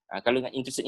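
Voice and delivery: ordinary talk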